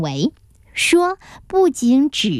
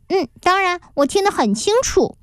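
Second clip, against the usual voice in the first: put-on high, sure child's voice; higher voice of a confident kid; 8 semitones higher than usual. A narrator's reading. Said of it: "花一朵似乎想维护神话".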